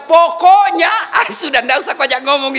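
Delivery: loud mock wife's final word; nagging wife. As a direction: bark it like a wife ending the argument. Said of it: "kalau dia sudah bilang".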